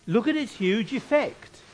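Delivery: normal talking voice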